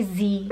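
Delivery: "Z" pronounced "zee", the American way. Shown as a demonstration. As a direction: say "Z" to rhyme with "see". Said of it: "I refuse to say Z".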